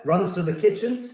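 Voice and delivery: ordinary delivery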